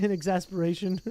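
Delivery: ordinary delivery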